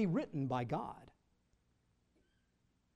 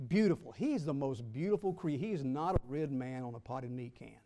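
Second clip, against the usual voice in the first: ordinary delivery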